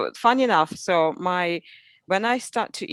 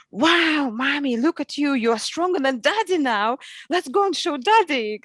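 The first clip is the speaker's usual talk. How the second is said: put-on excited child voice; 7 semitones higher than usual. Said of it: "And he's like".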